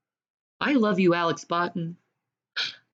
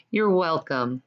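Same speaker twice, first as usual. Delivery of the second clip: ordinary delivery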